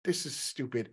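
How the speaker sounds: hushed, half-whispered mutter; muttered under breath. Retold or imitated. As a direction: mutter it to yourself. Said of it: "but I'm like".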